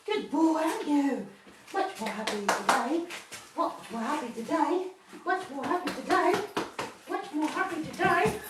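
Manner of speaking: puppy-talk voice. Real, not acted. Trainer praising the puppy in a high sing-song coo. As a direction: coo at the puppy, rising each time. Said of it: "a voice just because of interacting".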